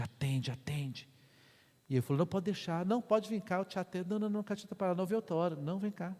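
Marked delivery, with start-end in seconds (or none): 0.0-1.1 slow, insistent whisper; whispered inner voice. Retold or imitated. Inner voice repeats the command. 2.2-6.1 higher-pitched back-and-forth protest; hurried polite insistence. Retold or imitated. Acted back-and-forth with the young man.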